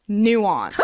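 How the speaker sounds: dramatic reveal. Acted.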